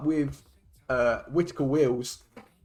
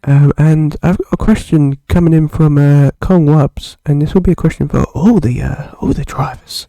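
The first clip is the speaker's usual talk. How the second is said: mock seductive voice; 14 dB louder than usual. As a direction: put on a hammy seductive tone. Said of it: "how about I just talk seductively to you".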